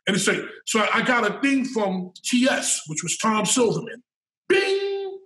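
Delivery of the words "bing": a bright high "bing" like an alert sound; ping it like a computer message popping up; mock notification chime. Imitating the office messaging alert, a high chime.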